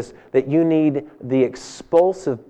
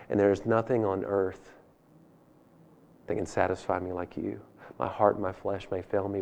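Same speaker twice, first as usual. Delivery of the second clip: tender prayer; 6 semitones lower, 12 dB quieter than usual. Acted. Preacher voicing David's prayer to God, softly tender. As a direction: breathe the psalm gently, like a lover.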